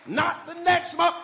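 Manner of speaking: high, loud urgent cry; preaching shout. Real, not acted. Preacher shouting to the congregation for urgency. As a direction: demand it now at full force.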